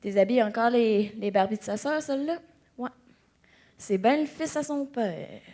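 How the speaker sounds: low, soft, resigned aside; muted disapproval. Retold or imitated. Relative commenting on the Barbie undresser, quiet disapproval.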